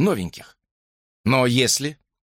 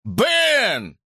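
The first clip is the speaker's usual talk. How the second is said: high, long-held call; 9 semitones higher than usual. A narrator's reading. Father searching for his son, calling out. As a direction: call a name from afar, pitched up.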